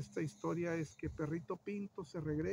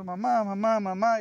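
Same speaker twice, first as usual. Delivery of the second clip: loud, higher mock call for mom; mock child's cry; 4 semitones higher, 7 dB louder than usual. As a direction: imitate a boy shouting as he runs. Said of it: "sigue gritando". (grito fingido de niño, fuerte y agudo)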